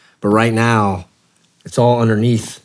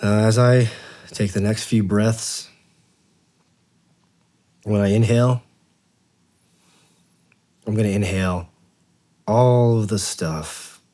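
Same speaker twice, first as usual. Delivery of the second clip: guided meditation. Acted. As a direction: talk them gently down to the beach.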